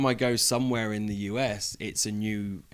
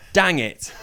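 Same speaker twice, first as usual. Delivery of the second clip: British-accented "dang it", raised and loud; 7 semitones higher, 8 dB louder than usual. Shown as a demonstration. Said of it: "you can't say it in a British accent".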